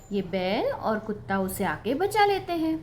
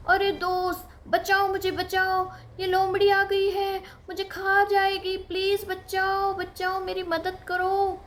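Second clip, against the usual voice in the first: mock cry for help; 8 semitones higher than usual. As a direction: wail for rescue as a prank again. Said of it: "फिर से मजाक वही किया". (ऊँची नकली पुकार)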